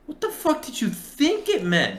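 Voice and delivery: high, slow disbelief; mock outraged high question; incredulous. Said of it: "we're like".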